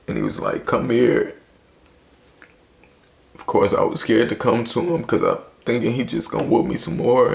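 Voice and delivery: fighting back tears while telling it; tearful. Real, not acted. Voice strained after breaking down.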